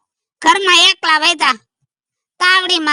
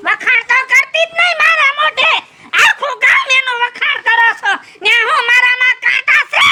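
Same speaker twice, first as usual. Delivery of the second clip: indignant rant; 4 semitones higher than usual. Acted. Old man shouting at neighbour about wife's praise. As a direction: shout the grievance high.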